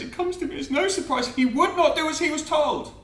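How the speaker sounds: very high-pitched put-on mother; mother's voice. A narrator's reading. Mother in the poem remarking on her son's death, high put-on voice.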